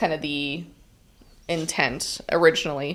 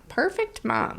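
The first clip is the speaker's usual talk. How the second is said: high, soft mimicry; lightly mock the phrase, pitched up; mocking quote; 9 semitones higher, 6 dB quieter than usual. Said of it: "people who say things like that".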